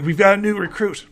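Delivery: high, loud and quick announcement; calling out. Acted. Soldier calling into the sleeping man's tent. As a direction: call into the tent.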